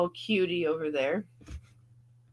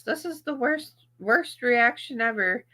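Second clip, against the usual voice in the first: higher, mocking the haters' complaint; 8 semitones higher than usual. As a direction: parrot the critics in a sneering tone.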